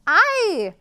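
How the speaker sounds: high, loud pitying ay; sympathetic ay. Acted. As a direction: feel her pain. Big goat sympathizes.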